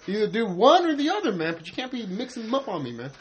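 half-hammed retold complaint; mock exasperated rant. Telling off a friend for switching speech styles, high exasperated rant. Retelling his outburst at a friend.

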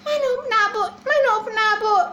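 put-on high, pleading little-child whine; whiny child voice. Baby elephant whining to his mother for candy. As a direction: beg like a spoiled toddler.

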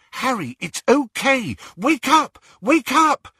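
high, loud, insistent calling; urgent waking call. Acted. Friend waking a man from a nightmare, urgent. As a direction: shout to rouse a sleeper. Friend tries to wake dreamer.

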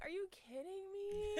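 high, slow, quiet incredulous whine; mock disbelief. Fan seeing scalper prices, quiet high disbelief. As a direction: act stunned at the resale prices. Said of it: "I'm like".

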